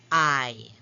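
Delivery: "I" said alone as a long vowel, falling; long I sound. Shown as a demonstration. Teacher showing Y as a vowel, long "I" sounded alone. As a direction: isolate and hold the long "I". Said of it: "because you are hearing".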